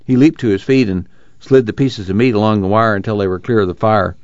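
ordinary delivery

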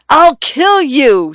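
high, drawn-out, narrated scream; screamed threat. A narrator's reading. Woman threatening the man at the gate, screamed. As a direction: scream it slowly, pitched far above narration. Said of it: "she screamed".

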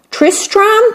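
high hopeful guess. A narrator's reading. Queen guessing.

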